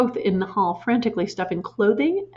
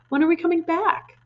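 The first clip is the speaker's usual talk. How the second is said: pleading; 9 semitones higher than usual. A narrator's reading. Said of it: "Matilda cried".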